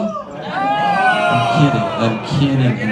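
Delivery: slow, stretched I'm kidding; drawn-out mock kidding. Comedian to the crowd, slow drawn-out mock apology. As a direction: stretch out the joke apology slowly. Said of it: "Jesus, relax. It's a joke".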